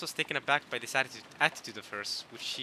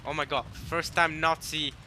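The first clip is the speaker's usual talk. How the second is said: ordinary delivery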